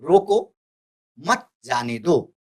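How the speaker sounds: rising "रोको", a brief stop, slow falling "मत जाने दो"; pause after रोको. Shown as a demonstration. Teacher shows students the comma, pausing after "रोको". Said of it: "थोड़ी देर हम रुकिए".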